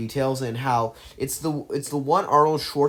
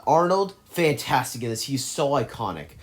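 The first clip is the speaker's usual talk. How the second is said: ordinary talk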